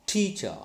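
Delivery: "teacher" with TEA high and falling cha; first-syllable stress. Shown as a demonstration. Teacher confirms the rule, "teacher" stressed on TEA. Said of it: "the stress should be on".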